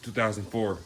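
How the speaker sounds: normal talking voice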